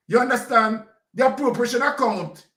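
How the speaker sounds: ordinary talk, nothing marked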